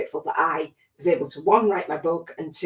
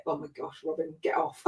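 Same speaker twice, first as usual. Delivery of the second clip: emotional; 2 semitones lower, 10 dB quieter than usual. Real, not acted. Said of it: "that you're emotional".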